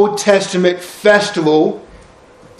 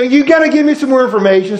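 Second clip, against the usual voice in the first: pleading Moses; 6 semitones higher, faster than usual. Retold or imitated. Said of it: "You know".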